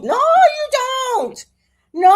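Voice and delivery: high, slow, loud No you don't; drawn-out no. Real, not acted. Scolding the Sag who tells truth, long loud no. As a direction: stretch it out high and loud in protest. Retort to Sag's honesty.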